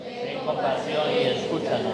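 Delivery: faint, far-off congregation reply; cathedral echo. Real, not acted. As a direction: voices answering from the back of a church. Congregation responds in church.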